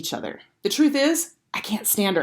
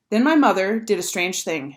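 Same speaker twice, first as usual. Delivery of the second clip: usual speaking voice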